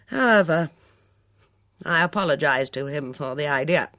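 loud, emphatic, forthright countrywoman; brisk no-nonsense woman. A narrator's reading. Witness bluntly answering the inspector's questions, brisk and loud. Voices Miss Hinchcliffe.

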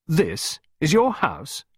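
slow, drawn-out incredulous question; can't believe what he's hearing; disbelief. Acted. Costello to Clarissa, slow and disbelieving about the house. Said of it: "His tone was one of disbelief".

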